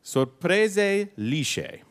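very slow, savored Italian; Italian accent. Pretend. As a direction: draw out each Italian syllable. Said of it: "Your Italian accent is phenomenal".

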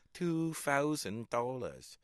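slow Hong Kong accent imitation. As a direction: mimic the shopkeeper's accent, slowly. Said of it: "I'd be giggling to myself".

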